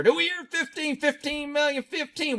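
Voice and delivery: high mock auctioneer call. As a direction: take bids like an auctioneer. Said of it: "Sounds like I'm an auctioneer".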